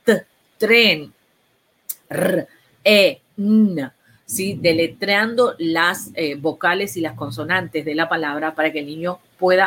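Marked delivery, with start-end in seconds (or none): 0.0-3.9 "t, tren, r, e, n" said letter by letter, each sound separate; spelling it out. Shown as a demonstration. Therapist spelling a word for a child, sound by sound. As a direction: spell "tren" out slowly, one sound at a time. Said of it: "Y vas a ir deletreando".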